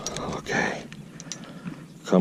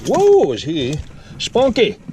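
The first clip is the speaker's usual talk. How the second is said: delighted exclamation; 11 semitones higher than usual. Real, not acted. Holding the wriggling fish, high and delighted. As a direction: marvel at the lively fish.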